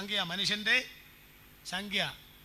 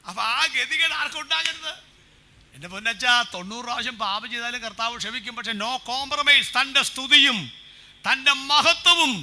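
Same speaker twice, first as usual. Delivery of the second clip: preaching at full force; 5 semitones higher, 13 dB louder than usual. Acted. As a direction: thunder it to the congregation. Preaching climax to congregation. (പൂർണ്ണ ശക്തിയിൽ പ്രസംഗം)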